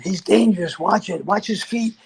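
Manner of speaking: alarmed warning. Retold or imitated. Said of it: "And they said".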